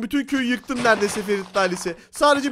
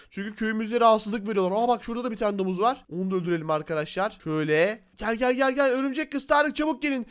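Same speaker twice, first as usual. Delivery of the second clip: ordinary delivery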